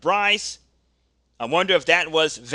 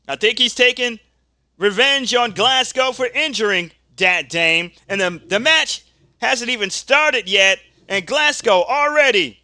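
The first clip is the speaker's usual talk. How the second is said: louder, higher announcer shocked by a surprise attack; excited wrestling play-by-play; 6 semitones higher, 4 dB louder than usual. Acted. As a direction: call the backstage brawl like live TV commentary. Calls a backstage wrestling attack live.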